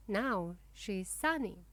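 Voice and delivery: usual speaking voice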